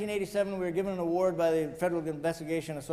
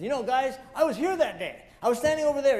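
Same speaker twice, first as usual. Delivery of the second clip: higher and louder, eagerly recounting; animated retelling; 6 semitones higher, 5 dB louder than usual. Retold or imitated. Policeman at Ground Zero tells the visitors, excitedly animated. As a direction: relive it for the guys, wound up. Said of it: "he was all animated".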